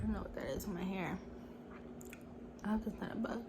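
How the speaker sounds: hushed worried whisper to herself; worried whisper about a bug in her hair. Real, not acted. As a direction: breathe it under your breath, unvoiced. Finds something in her hair.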